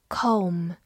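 Comb ending on an M sound; silent B. Shown as a demonstration. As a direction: say Comb as "kome", no B. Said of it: "往往B不发音哦".

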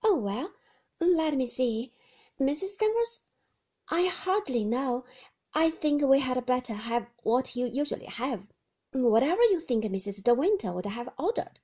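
eager to please, flustered. A narrator's reading. Flustered young wife on the phone.

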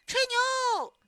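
high, drawn-out jeering yell; shouting together. A narrator's reading. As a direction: yell it like a whole crowd of kids. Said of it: "大家一起叫起来".

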